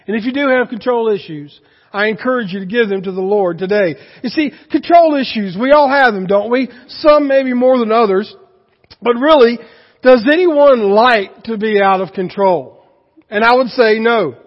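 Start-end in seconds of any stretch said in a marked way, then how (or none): 4.2-14.4 preaching. Acted. Preacher raising intensity.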